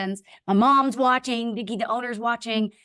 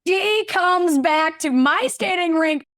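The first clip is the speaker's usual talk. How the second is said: high, slow, drawn-out reveal; dramatic disbelief; 6 semitones higher, slower than usual. Retold or imitated. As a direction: draw out the rival's outrageous return. Reveals the rival's dramatic return.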